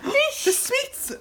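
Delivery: high, excited gasp before the word sweets; gasp, then sweets pitched high; gasping excitement. Real, not acted. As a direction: make it a big thrilling reveal. Gasping reveal to child.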